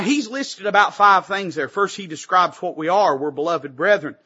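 normal talking voice

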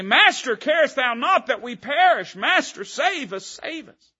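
high, pleading cry to the Master; panicked plea. Acted. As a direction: beg to be saved from drowning. Disciples crying out in storm.

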